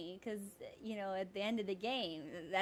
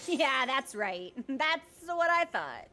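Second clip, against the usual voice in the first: gloating; 4 semitones higher, 9 dB louder, slower than usual. Real, not acted. Gloating after a win.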